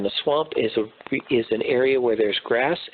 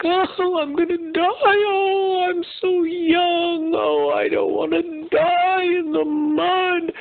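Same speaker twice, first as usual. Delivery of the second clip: high, slow, whiny sobbing zebra voice; overly dramatic zebra; slower than usual. Acted. Zebra stuck in the mud wailing to himself, hammed-up sobbing. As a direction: wail like a hammed-up crybaby zebra. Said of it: "screaming and crying and going on and on".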